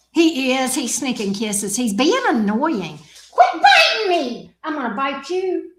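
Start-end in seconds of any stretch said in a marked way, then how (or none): 3.3-4.5 high, loud protest at the biting; real yelp. Real, not acted. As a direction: cry out as the teeth sink in. Dog bites speaker.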